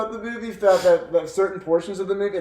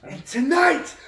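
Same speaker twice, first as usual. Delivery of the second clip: movie battle cry; 8 dB louder, slower than usual. Retold or imitated. Quoting his favourite movie line to friends, slow roaring battle cry. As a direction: bellow it like a king rallying his army. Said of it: "That was a banger".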